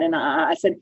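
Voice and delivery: ordinary talk, nothing marked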